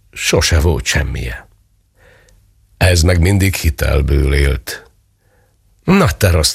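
slow, drawn-out mock preaching to each drawer; lightly voiced mother scolding the empty drawer, slow; mock little sermon. A narrator's reading. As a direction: lecture the drawer like a playful preacher. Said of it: "tartott egy kis prédikációt".

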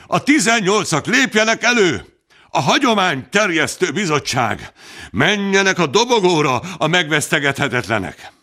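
high, calling voices of the crowd. A narrator's reading. Townsfolk summon the incorruptibles to the platform, calling out. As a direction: call the eighteen up to the stage. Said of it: "Hangorkán".

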